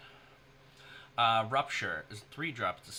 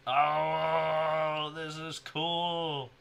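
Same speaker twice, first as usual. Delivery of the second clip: slow, awed and louder; 6 semitones higher, 6 dB louder, much slower than usual. Real, not acted. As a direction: let the wonder stretch out. Awed by a new card.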